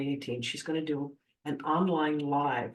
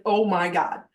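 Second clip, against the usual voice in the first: loud, freaked-out exclamation; mock freak-out at full volume; retold freak-out; 2 semitones higher, 8 dB louder, slower than usual. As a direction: re-enact being overwhelmed, punched out loud. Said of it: "I'm freaking out".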